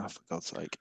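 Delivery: low, quiet fed-up groan; muttered exasperation. Retold or imitated. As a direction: drop it under your breath. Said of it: "It's like".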